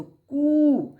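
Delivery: कू high and loud with a long oo; long vowel. Shown as a demonstration. Teacher contrasts long u with short u, long vowel. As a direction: stretch the oo in कू. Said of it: "ये बड़ा है तो बड़ा उच्चारण".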